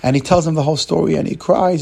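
ordinary delivery

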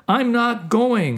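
high, stubborn refusal; mock yell. A narrator's reading. Soul refusing the mission, high stubborn yell. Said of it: "you yelled".

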